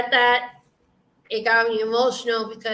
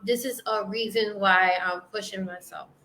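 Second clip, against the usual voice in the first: ordinary delivery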